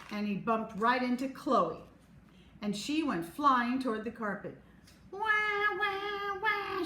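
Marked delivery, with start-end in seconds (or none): 5.1-6.8 high sing-song fake wail; a whiny hammed-up boo-hoo. A narrator's reading. Chloe wails on the floor, high sing-song. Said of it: "she wailed".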